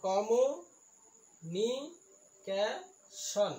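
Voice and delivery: slow, drawn-out syllables with gaps. Shown as a demonstration. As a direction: sound out each long word piece by piece. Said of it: "ইয়াক আমি পলিচিলেবুল বুলি কওঁ". (প্ৰতিটো চিলেবুল ভাঙি লাহে লাহে)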